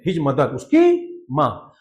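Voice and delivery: stressed His mother. Shown as a demonstration. Explains the emphasized word.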